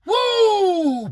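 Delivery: loud high joyful whoop; excited whoop. Real, not acted. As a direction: let out a big celebratory woo. Opening excited cheer.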